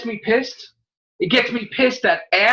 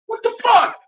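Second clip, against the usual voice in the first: the whole crowd blurting in disbelief; audience outrage; 13 semitones higher than usual. Retold or imitated. People in the theater reacting, a high outraged cry. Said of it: "people were like".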